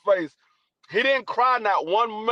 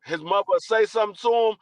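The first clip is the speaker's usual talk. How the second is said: normal talking voice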